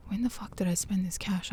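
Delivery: half-whispered, baffled question to oneself; hushed disbelief. Retold or imitated. Woman finding her cash gone, hushed disbelief. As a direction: drop to a near whisper, as if thinking aloud.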